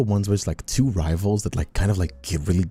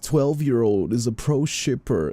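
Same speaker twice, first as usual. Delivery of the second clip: mocking gush; 6 semitones higher, slower than usual. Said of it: "it's just like".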